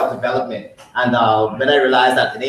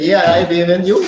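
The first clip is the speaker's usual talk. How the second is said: retold excited yes, higher and louder; excited reply; 6 semitones higher, 7 dB louder than usual. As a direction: answer with glad recognition. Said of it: "I said".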